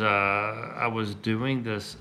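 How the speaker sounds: ordinary delivery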